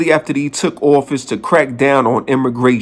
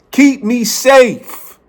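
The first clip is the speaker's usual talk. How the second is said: high, slow, hammed-up frightened plea; mock scared plea; 9 semitones higher, much slower than usual. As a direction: play a pretend scared citizen begging for safety. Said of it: "I'm scared".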